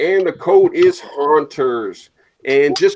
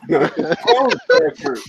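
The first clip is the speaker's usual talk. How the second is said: high, laughing repeat. Real, not acted. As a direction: crack up saying it.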